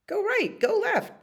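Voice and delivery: high, squeaky commands; herding dog. Acted. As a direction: give orders like a little herding dog.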